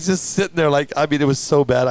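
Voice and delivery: normal talking voice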